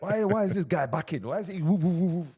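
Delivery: very high, loud elder impression ending in hoots; hammed-up baffled elder, wailing the woos; mock older generation. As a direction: imitate an outraged old-timer, loud and high. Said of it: "the older general would be like".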